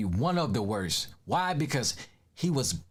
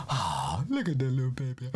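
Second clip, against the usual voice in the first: put-on cooing at baby; 3 semitones lower than usual.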